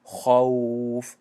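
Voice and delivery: خوف drawn very slowly with a heavy round خا; full kha. Shown as a demonstration. Teacher isolating the heavy kha in خوف. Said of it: "دهان پر شد".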